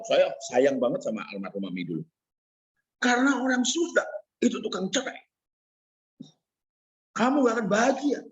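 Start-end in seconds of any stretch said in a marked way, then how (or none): 3.0-8.2 retold angry mother. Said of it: "mami saya ngamuk berat".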